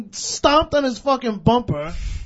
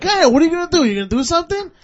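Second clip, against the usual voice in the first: loud, raised re-enacted goading; retold confrontational dare; 6 semitones higher, 7 dB louder, much faster than usual. Driver goads cabbie to act, retold loud challenge. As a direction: goad him as in the retelling.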